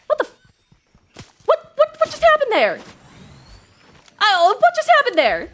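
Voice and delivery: high, loud disbelieving what; can't believe what just happened; baffled surprise. Real, not acted. Confused by vanished enemy.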